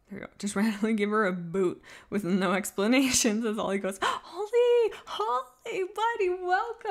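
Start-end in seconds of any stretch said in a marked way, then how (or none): 4.0-6.9 much higher and louder, delighted squeal; squealing greeting. Acted. Streamer squealing a welcome to viewer Holly. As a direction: squeal their name like a thrilled fan.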